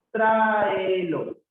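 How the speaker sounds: "Tráelo" drawn out from a high "Trá", falling; stretched syllables. Shown as a demonstration. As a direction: stretch every vowel of "Tráelo". Teacher sounds out word for accent. (alargando las vocales, empezando agudo)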